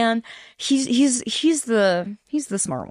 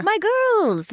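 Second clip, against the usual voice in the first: doting dad; 11 semitones higher than usual. Retold or imitated. Dad greeting daughters, retold.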